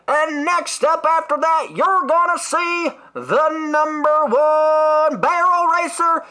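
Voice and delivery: drawn-out, high booming announcer voice; rodeo announcer. Retold or imitated. Cowboy-hat host hyping the rodeo crowd, slow booming announcer. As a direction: stretch every word like a hype announcer. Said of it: "he's like".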